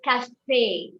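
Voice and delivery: café with a long, stressed final "ay"; accented final E sounded. Shown as a demonstration. French teacher showing the accented E in café, sounded final "ay". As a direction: say café slowly, landing on a drawn-out "fay". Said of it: "You give a A sound".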